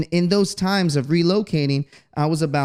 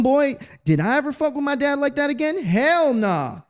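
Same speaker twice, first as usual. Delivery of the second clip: emphatic; 11 semitones higher than usual. Real, not acted. Rhetorical emphatic remark.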